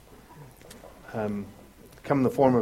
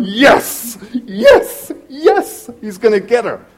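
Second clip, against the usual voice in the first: rising yes yes yes, higher and louder each time; gleeful yes; 6 semitones higher, 6 dB louder, much slower than usual. Retold or imitated. Alma seeing the cop's lights, gloating higher and louder. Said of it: "and she's going".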